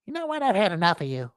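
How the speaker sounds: high squeak dropping into defiance; sudden voice change. Acted. Wallet turning on its owner, voice suddenly changing. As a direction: start squeaky, then turn fed up. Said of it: "their voice changes all of a sudden".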